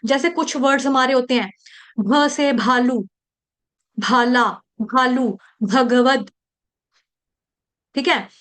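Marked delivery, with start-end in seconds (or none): 2.0-6.3 भ in भालू thrown out with a strong puff of air; slow, forceful भ drill; forced aspirate भ. Shown as a demonstration. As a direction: push out the भ of भाला and भगवत. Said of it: "force से कोई चीज छोड़नी है, फेंकनी है".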